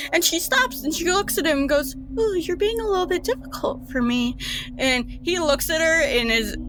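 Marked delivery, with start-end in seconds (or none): 2.2-4.4 much higher, quick playful coo; teasing coo. Acted. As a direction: tease him like a cat with a mouse.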